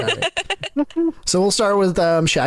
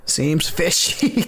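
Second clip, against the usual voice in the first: slow drawn-out pun; much slower than usual. Pretend. Game master repeating the player's pun, slow and drawn out. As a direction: draw out seems fishy slowly, rising on fishy.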